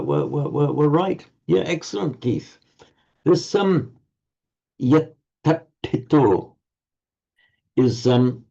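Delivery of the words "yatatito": yatatito, slow, doubled aspirated dental then retroflex; curled tongue heavy consonants. Shown as a demonstration. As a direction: yatatito drawn out carefully. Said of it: "is a bit of a tongue twister".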